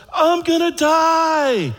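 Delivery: high, slow, loud wail; loud yell. Retold or imitated. Old patient next door yelling, loud and slow. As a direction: yell it out like a scared old man. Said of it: "yells out really loud".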